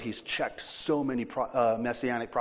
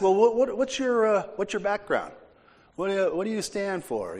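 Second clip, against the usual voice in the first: higher, fussy haggling questions; mock questioning voice; 8 semitones higher than usual. Preacher voicing disciples who might question Jesus, mock fussy tone. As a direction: play a doubter bargaining before he follows.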